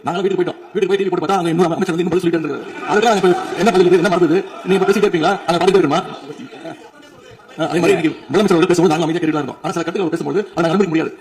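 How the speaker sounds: ordinary talk, nothing marked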